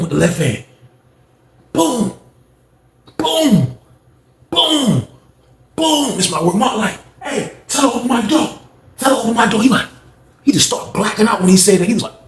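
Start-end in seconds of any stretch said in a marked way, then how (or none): 1.7-6.2 high falling "boom"; imitated slam sound. Miming repeated slam blows. 7.2-9.6 imitated calling out. Cellmate calling out for help.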